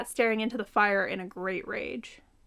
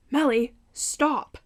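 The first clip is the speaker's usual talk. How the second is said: pleading; 3 semitones higher, 5 dB louder, much slower than usual. A narrator's reading. Tony pleads during the storm.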